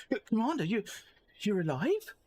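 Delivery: high, startled disbelief; mock astonished reunion; surprised soldier. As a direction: gasp it out like you thought they were dead. Reading a surprised NPC line.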